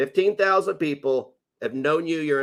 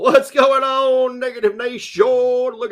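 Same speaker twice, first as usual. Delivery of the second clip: stretched, louder show opener; loud slow greeting; 9 semitones higher, 7 dB louder, slower than usual. Shown as a demonstration. Streamer booming a welcome to his viewers. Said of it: "show greeting, slow loud".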